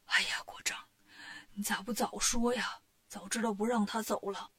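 soft, breathy whisper of regret; a sick old king lamenting weakly; feeble king. A narrator's reading. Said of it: "说话都没力气".

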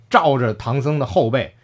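usual speaking voice

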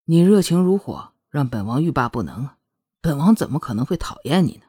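low, wicked chuckle into silky teasing; devilishly seductive. A narrator's reading. Prince pulls Shuangyue into his arms, devilishly seductive. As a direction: purr it low, like a roguish prince. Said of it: "邪魅的声音包围了双月".

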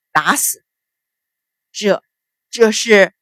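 halting, stunned questions in broken pieces; shocked blurting. A narrator's reading. Father hearing his daughter had servants killed, shocked. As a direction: blurt it in mild shock, stumbling between words. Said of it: "失声道".

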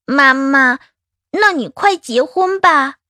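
put-on high, drawn-out childlike plea; pleading little child. Child urging mother to marry, high and eager. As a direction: beg mummy like a hopeful small child. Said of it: "眼巴巴地看着我说道".

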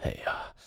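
hushed, whispered sigh of dismay; weary sigh. Acted. Old uncle despairing at the quarrelling family, sighing. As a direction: sigh it out under the breath. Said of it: "最后他摇头叹息".

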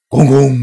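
loud, high imitated blast; booming impact. A narrator's reading. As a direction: bang it out like a hit. Laser blast hits ship.

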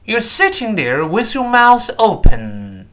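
slow, high, loud line for repeating; slow model reading. Shown as a demonstration. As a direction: draw it out clearly for learners. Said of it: "慢慢来".

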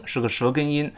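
usual speaking voice

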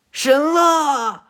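high, loud, full-throated scream of the name; anguished scream. Acted. Boruto collapsing and screaming Kagura's name, anguished high yell.

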